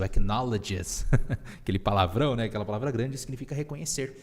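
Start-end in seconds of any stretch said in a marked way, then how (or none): 0.0-1.1 acknowledges spelled out slowly, syllable by syllable; slow careful English. Shown as a demonstration. Teacher to students, slowly sounding out a hard word. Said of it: "aquela palavra ali, ó, difícil".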